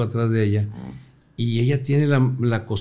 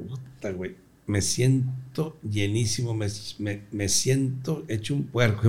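mock groan; 6 dB quieter, slower than usual. Acted overstuffed after dinner.

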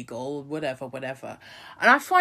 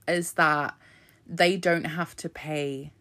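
ordinary talk, nothing marked

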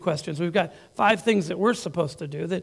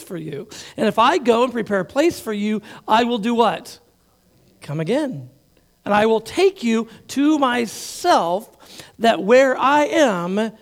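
preaching at full force; 3 semitones higher, 4 dB louder than usual. Acted. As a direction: proclaim the promise. Preaching Jesus' words.

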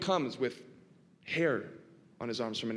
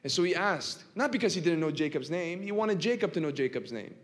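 ordinary talk, nothing marked

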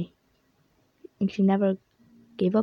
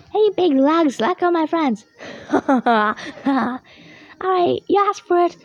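dopey cartoon bellow; 6 semitones higher than usual. Hero taunting villain.

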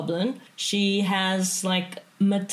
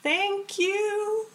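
squeaky goblin voice; 13 semitones higher than usual. Acted. Goblin thanks the stranger.

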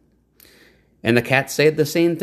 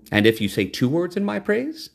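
the cat; 7 semitones higher than usual. A narrator's reading. Cat voiced in story.

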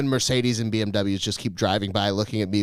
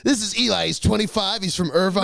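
higher-pitched pretend host intro; mock dating-show announcer; 6 semitones higher than usual. Joker presenting friend as dating contestant, mock announcer.

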